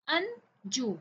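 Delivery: Anju split into अन, pause, जू; syllable by syllable. Shown as a demonstration. Teacher showing two syllables in a name, syllable by syllable. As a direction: say Anju as two separate beats. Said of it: "इसमें भी टू syllable है".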